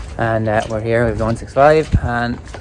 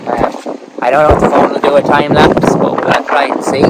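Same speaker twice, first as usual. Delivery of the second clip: shouting over wind; 3 semitones higher, 4 dB louder than usual. Real, not acted. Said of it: "hear me over this wind".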